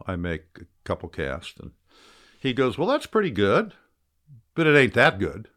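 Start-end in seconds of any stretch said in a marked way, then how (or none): 2.8-5.5 higher, louder mock teasing verdict; playful jab from the old pro; Lefty teasing. Guest imitating Lefty judging his casting, higher and teasing.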